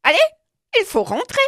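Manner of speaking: put-on little girl telling the dog it's time to go; joyful girl. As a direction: cheerfully hurry the dog home. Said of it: "lui dit-elle joyeusement".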